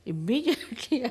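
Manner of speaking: normal talking voice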